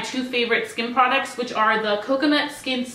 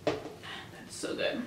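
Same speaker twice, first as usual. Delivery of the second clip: soft whisper after a sip; 15 dB quieter, much slower than usual. Real, not acted. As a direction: breathe it out, slowly. Whisper after sipping iced coffee.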